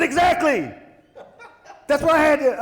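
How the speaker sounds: high, loud laughing reply; laughing high and loud while retelling his answer. Retold or imitated.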